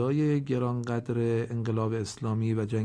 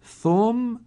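ثم said much higher and louder, the doubled م held; doubled letter held; 9 semitones higher, 6 dB louder than usual. Shown as a demonstration. Teacher reciting a Quran example to students, loud and held. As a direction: ring out ثم and hold the م.

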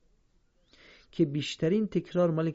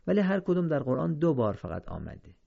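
normal talking voice

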